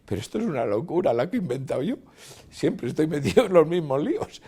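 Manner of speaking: loud, high, exasperated alarm; retold panic. Cameraman berates himself as horses bear down, retold panic.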